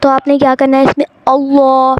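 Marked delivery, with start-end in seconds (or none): 1.3-2.0 अल्लाह with a thick, rounded लाम; deliberate tajweed demo of अल्लाह; full, heavy lam. Shown as a demonstration. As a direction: hold the heavy second syllable of अल्लाह. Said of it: "उसको हमने पुर पढ़ना है".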